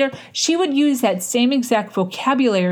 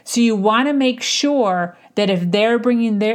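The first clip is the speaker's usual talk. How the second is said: usual speaking voice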